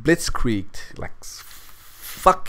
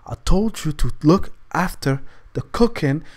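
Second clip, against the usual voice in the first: higher-pitched imitation of a mother; scolding mother; 5 semitones higher than usual. Mom scolding son about the cooking, imitated. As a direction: lecture like a mom who left instructions. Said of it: "what basically my mom said to me".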